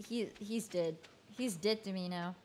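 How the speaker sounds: normal talking voice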